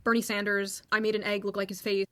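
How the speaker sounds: ordinary delivery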